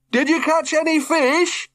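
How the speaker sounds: high call down from a tree; father calling down from the branches. A narrator's reading. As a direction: shout down to someone below. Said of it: "he called down".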